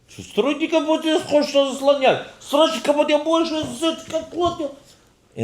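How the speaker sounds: very high, loud gibberish yelling; mock Ukrainian shouting. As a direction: imitate an angry crowd screaming in Ukrainian. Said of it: "Und man hat sich schreien". (נאכמאכן אוקראיניש געשריי)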